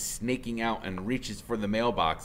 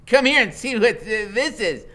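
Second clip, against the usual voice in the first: higher, louder slurred yell; rough woman calling; 13 semitones higher, 8 dB louder than usual. Acted. Woman in doorway calling her man, loud. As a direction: holler back over her shoulder. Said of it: "she says".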